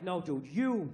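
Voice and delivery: low, dopey buddy voice; dog's voice. Retold or imitated. Comic voicing the adoring dog praising its owner, low and dopey.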